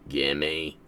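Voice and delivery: the same low grumbled demand again; low greedy gimme. Pretend. Player pleading again with the game, grumbling low. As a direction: mutter it low, still wanting it.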